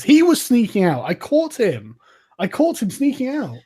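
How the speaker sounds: high accusing cry, caught him. Acted. Acolyte accusing Dev before the brothers, high accusing cry. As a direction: point and cry out the culprit. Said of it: "and says".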